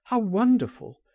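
high, wondering exclamation; excited cry. A narrator's reading. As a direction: let the wonder lift the voice high. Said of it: "he cried".

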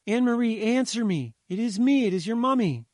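high, loud, urgent mother's plea; calling out. A narrator's reading. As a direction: call desperately to a child on the phone. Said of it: "she called".

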